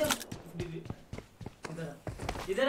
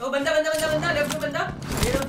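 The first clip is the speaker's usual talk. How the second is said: excited callout; 9 semitones higher, faster than usual. Real, not acted. Spotting an enemy, calling out.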